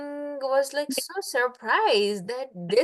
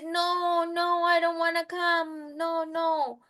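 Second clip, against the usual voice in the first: a higher voice, lightly played frightened cow; pleading cow; 6 semitones higher than usual. Cow begs not to be dragged off, higher pleading voice. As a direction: beg not to be taken, in a high small voice. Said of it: "And cow says".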